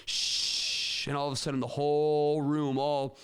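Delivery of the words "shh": sustained group shush; long shushing. Retold or imitated. Two hundred teachers shushing each other, long shush. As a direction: imitate a whole room shushing. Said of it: "like they were shushing each other".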